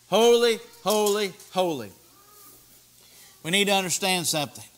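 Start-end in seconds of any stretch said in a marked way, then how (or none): 0.1-1.9 raised call, falling away on the last word; angels' cry of praise, settling at the end; angels crying out. Retold or imitated. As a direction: proclaim each word separately, starting loud. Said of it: "the angels cried to one another".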